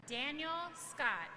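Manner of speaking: ordinary talk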